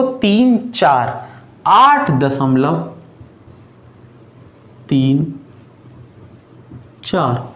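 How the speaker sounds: slow, spaced digits; teacher showing students to read the digits separately, slowly; digits read one by one. Shown as a demonstration. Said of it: "लेकिन हम इसको चौंतीस नहीं पढ़ेंगे".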